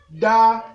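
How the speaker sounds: Da on a level middle tone. Shown as a demonstration. Teacher reading syllables, Da on mid tone. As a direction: hold Da evenly, moderately high. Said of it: "Ça donne ceci".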